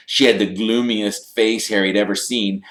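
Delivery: usual speaking voice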